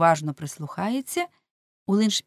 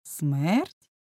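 "Смерть" with a sharply rising end; echo question. A narrator's reading. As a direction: query "Смерть" back, swinging the pitch upward. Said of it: "перепитав Улленшпігель". (перепитування: «Смерть» з висхідним тоном)

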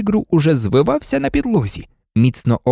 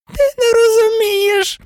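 very high, whining moan; moaned plea; 3 dB louder than usual. A narrator's reading. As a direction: moan it in a tiny, desperate voice. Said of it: "простогнав Петтігру". (високий жалібний стогін)

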